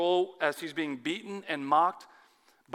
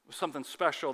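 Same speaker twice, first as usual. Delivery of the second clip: ordinary delivery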